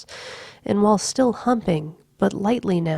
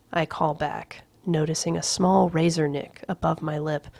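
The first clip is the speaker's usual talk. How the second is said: usual speaking voice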